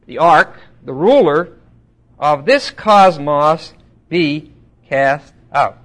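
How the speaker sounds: loud, very slow declaimed verse; weighty, deliberate Bible reading; scripture reading. Acted. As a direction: hammer out the verse word by word. Reads scripture slowly and loudly.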